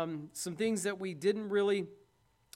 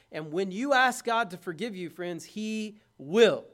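emphatic promise; 2 semitones higher, 8 dB louder than usual. Real, not acted. Preaching promise, builds.

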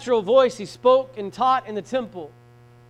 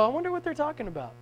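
quick, softer thought to himself; wondering aside; 3 semitones higher, 7 dB quieter, faster than usual. Retold or imitated. Preacher wondering about whisperers in the crowd, quiet aside. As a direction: drop it to a quick private musing.

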